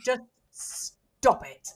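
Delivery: mock exasperated plea. To colleagues joking about follow through, mock exasperated. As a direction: drag the words out in mock despair. Pleading with teasing colleagues, retold.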